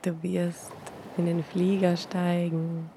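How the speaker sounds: normal talking voice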